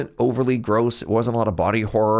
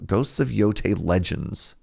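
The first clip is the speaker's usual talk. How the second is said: ordinary talk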